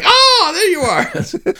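slow, high, laughing gush; delighted high greeting. Retold or imitated. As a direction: coo it out with a laugh. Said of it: "he's like".

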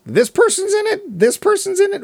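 high, mocking repeated list; mock note-reading. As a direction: parrot your own boring notes in a silly high voice. Reading out own dull notes.

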